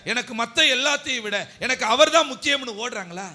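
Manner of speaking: loud, high, insistent preacher; impassioned preaching. Real, not acted. Preacher listing what believers leave for God, impassioned preaching.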